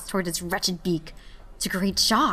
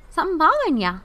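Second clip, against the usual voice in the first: bartender; 10 semitones higher, 6 dB louder, faster than usual. Acted. Bartender voiced in story.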